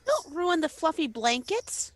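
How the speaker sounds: ordinary delivery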